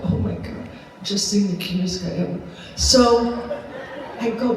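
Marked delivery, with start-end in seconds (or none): 0.0-2.4 hushed aside. Retold or imitated. Confiding a crush to herself.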